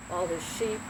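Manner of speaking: plain reading voice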